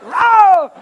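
high, loud mock gasp of delight; mock thrilled crowd. Preacher imitating his fired-up listeners, high mock cry. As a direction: mimic an audience egging on the fire. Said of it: "You like".

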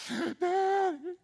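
put-on very high, childlike pleading wail; high put-on child voice, begging; whiny little boy. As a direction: play the innocent little boy to dodge a whipping. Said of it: "You really had to put on a performance".

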